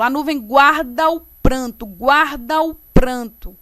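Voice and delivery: emphatic demo joining GUARDA and O before PRANTO; run together. Shown as a demonstration. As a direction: show firmly how the vowels merge. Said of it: "observem como é que eu falo".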